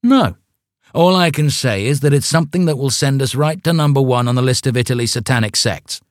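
boastful. Acted. Leader boasts, refusing.

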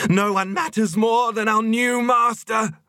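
very high, held fanatic proclamation; ecstatic cry. A narrator's reading. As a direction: cry it out rapturously, piping high. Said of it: "she cried".

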